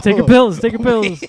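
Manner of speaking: insistent. Acted. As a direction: nag loudly. Insisting louder.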